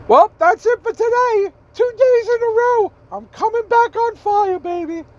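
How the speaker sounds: a high, buzzing "coming back on fire" send-off; excited sign-off. Real, not acted. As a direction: sign off hyped and triumphant. Hyped vlog sign-off.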